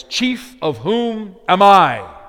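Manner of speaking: slow and loud, landing hard on "I"; stress on I. Shown as a demonstration. Preacher showing Paul's Greek word order, stress on I. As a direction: drag out "Chief of whom am I?" and punch the "I". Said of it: "all of the emphasis falls on the word I".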